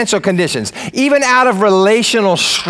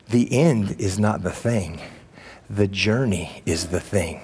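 soft slow preaching; 13 semitones lower, 10 dB quieter than usual. Acted. Quiet reflective quote.

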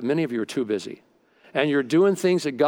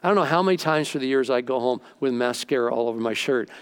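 usual speaking voice

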